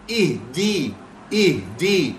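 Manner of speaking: "اہدی" as "ih-dee", clear "ee"; "اہدی" in two separated syllables, ih, dee; correct zer. Shown as a demonstration. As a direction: model "اہدی" with short "ih" and a firm "dee". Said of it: "چھوٹی یا کی آواز نکالنی ہے".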